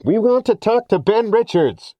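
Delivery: police bullhorn calling out to the car; blaring bullhorn. A narrator's reading. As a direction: blare it like a police loudspeaker. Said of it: "asexual blaring amplified".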